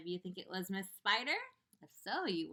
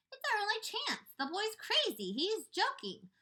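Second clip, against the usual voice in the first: scoffing; 4 dB louder than usual. Acted. Creatures scoff at James.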